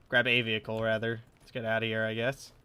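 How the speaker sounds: ordinary delivery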